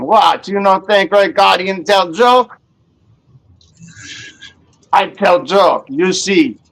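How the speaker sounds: lightly voiced Russian accent, Red Guardian style; broken-English joke in a mock Russian accent; a hint of Russian accent as the Red Guardian comic. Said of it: "with a Russian accent".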